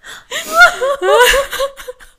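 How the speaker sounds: high wet laugh breaking into a cry; sobbing laugh. Real, not acted. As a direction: let the laugh crack into a sob. Overwhelmed sob-laugh.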